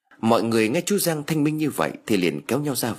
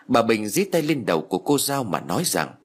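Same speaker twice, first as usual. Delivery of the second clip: usual speaking voice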